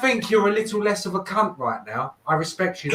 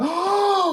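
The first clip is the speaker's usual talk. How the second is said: gasp; 13 semitones higher than usual. Pretend. Mock shock at thumbnail.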